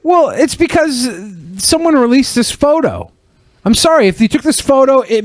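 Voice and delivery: high-pitched, exasperated pushback; exasperated rebuttal. Real, not acted. Host arguing back at co-host about the released photo, exasperated. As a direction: argue back with the voice pitched right up.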